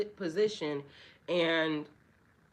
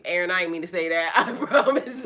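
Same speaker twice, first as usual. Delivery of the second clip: fast, laughing and loud; laughing apology; 9 dB louder than usual. Real, not acted. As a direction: giggle through the apology.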